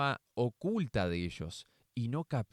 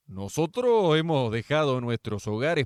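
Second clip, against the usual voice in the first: Peter speaking up; 8 dB louder than usual. Acted. Peter tells Jesus.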